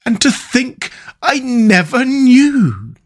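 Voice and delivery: high, slow, faraway Toad voice; dreamy Toad. A narrator's reading. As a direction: drift off into a daydream. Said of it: "in a dreamy monotone".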